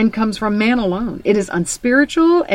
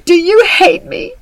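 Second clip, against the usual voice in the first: put-on high, loud, pleading child voice; a hurt little girl begging her parent; daughter's upset cry; 10 semitones higher, 7 dB louder than usual. Daughter pleading with her mom, high and loud. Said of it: "And she goes".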